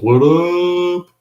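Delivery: high, hammed-up "what up?" with "up" stretched; mock cool greeting. Teacher greeting a former student in chat, mock cool. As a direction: play the cool kid saying "what up".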